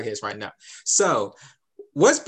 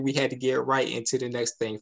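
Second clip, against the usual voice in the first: ordinary delivery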